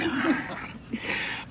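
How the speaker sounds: high airy chuckle; breathy laugh. Real, not acted. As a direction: let out a soft breathless giggle. Laughs again.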